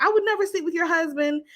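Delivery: high-pitched imitation of a crying denial; lightly hammed-up weepy denial; mock tearful protest. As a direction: mimic a tearful woman protesting her innocence. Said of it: "Jackie starts crying and shit".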